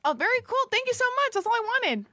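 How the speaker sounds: high sarcastic thanks; mock gratitude. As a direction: gush sarcastically.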